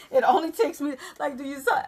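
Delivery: laughing. Real, not acted. Still laughing.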